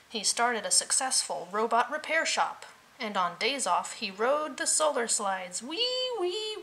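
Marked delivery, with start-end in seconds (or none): 5.6-6.6 very high squeal like a slide ride; high squealing wee. A narrator's reading. Reading to a child, a piggy squealing down the slide.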